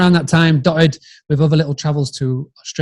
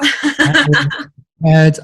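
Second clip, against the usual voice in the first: much higher and slow, a playful squeaky vow; mock singsong; 12 semitones higher, 4 dB louder, much slower than usual. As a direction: squeak it out slowly in a mock high voice. Answering "are you prepared to dance.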